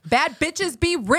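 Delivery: high, loud sassy cheer; hype shout. Real, not acted. Hyped book club shout.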